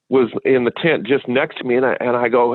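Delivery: ordinary talk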